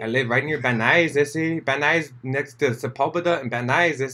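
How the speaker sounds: slightly high put-on Chicano accent. Shown as a demonstration.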